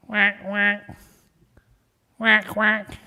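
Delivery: mock whack whack of wings; playful wingbeat sounds, a touch of drama; imitated wingbeats. Ducks flying overhead in story.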